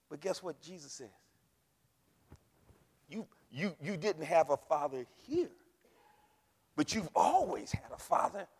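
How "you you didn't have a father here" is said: usual speaking voice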